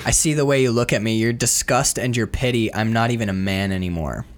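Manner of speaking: low, flat self-pity of a wounded man; brooding movie character. Acted. Retelling the wounded man's speech to the woman, low and defeated. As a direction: act out his disgusted, defeated line, pitched low. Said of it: "and he goes".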